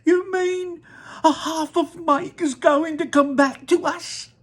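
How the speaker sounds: very high, loud shriek then pleading; horrified scream. Acted. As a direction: shriek in horror. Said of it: "let out a scream of horror".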